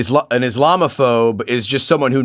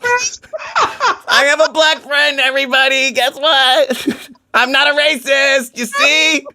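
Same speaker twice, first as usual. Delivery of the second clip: high, laughing mock boast to the world; mock proud announcement; 10 semitones higher, slower than usual. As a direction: announce it like proud news, cracking up. Mock bragging to everybody.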